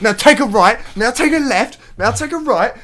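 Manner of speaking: loud, high bossy left-right calls; mock directions. Taking the piss, calling turns to the driver. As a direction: bark the turns to take the piss. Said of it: "I was like".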